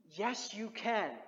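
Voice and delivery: ordinary talk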